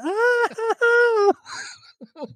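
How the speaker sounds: very high mock moans; mock moaning. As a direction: ham up a fan's moans.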